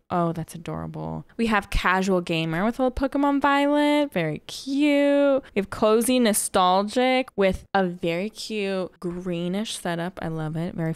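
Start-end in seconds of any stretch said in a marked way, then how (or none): 4.1-5.4 cutesy voice. Pretend. Reacting to cozy setup.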